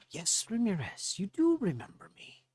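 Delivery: high, knowing gnome voice. Acted. Gnome answering Ramirez's unspoken thought, high and knowing. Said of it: "the gnome looks to you and says".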